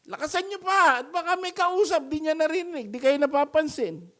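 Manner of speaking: very high, loud sing-song taunt; mocking Elijah. Preacher voicing Elijah mocking the prophets, high and taunting. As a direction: egg them on with a sneering high call. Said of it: "sabi ni Elijah".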